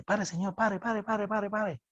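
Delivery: urgent repeated plea, imitated without shouting; mock desperate plea. Passenger begging the bus driver to stop, mock desperate. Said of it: "una señora que grita desesperadamente".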